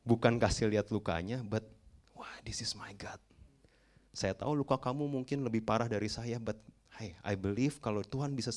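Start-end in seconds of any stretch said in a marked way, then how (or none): 2.2-3.2 slow, whispered wonder; soft whispered testimony; hushed awe. Acted. Acted awed testimony about God.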